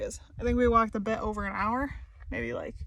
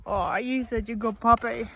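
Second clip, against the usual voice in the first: ordinary talk, nothing marked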